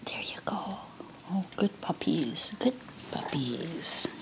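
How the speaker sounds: hushed whisper to the puppies. Real, not acted. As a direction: whisper softly to the dogs. Feeding dogs, whispering to them.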